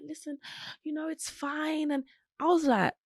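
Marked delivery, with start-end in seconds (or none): 0.0-1.9 soft, slow, hushed comfort; soft soothing boyfriend. Retold or imitated. Boyfriend soothing his crying girlfriend, hushed and slow. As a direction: soothe her gently and slowly. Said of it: "he was like".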